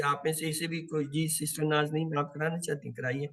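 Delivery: ordinary talk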